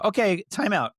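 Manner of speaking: high firm call for time out. Retold or imitated. Dad calling out to kids.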